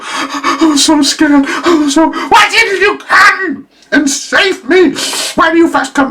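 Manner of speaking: high, loud shaken reproach; mock girl's shrill angry complaint; upset girl. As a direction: scold him for not saving her. Girl reproaching boyfriend after scare.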